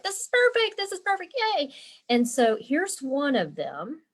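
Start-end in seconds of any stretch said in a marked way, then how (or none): 0.0-1.7 high-pitched retold delight; excited squeal. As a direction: squeal it like a thrilled kid. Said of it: "And I was like".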